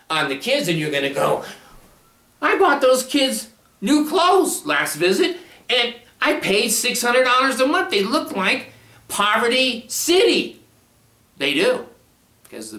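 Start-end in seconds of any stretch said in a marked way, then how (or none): 2.4-10.6 indignant father. Retold or imitated. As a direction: whine it out like an outraged dad. Father complains about ragged clothes.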